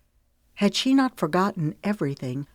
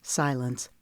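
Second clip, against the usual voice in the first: plain reading voice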